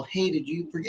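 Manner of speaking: quiet, tentative ask; polite, hushed query. Retold or imitated. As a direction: ask it softly and carefully. Politely asking about forgotten order.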